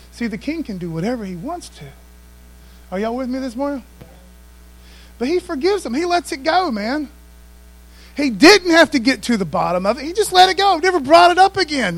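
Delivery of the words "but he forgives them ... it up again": high, loud, rising preacher's cry; preaching climax. Acted. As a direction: pastor driving home David's forgiveness, high and loud.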